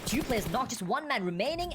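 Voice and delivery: usual speaking voice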